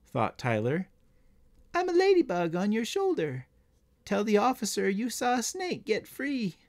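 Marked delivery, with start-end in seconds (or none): 1.7-6.6 high-pitched tiny bug voice; coaxing ladybug. A narrator's reading. Ladybug on a boy's shoulder urges him to tell, high coaxing. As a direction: coax in a small, high voice. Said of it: "coaxed the ladybug".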